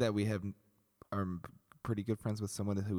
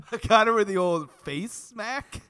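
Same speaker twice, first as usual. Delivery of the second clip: incredulous laugh; 12 semitones higher, 8 dB louder, slower than usual. Real, not acted.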